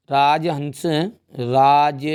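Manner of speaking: one word stretched very long; slow dictation. Real, not acted. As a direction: draw it out slowly while writing. Drawing the word out while writing. (लिखते हुए खींचकर बोलना)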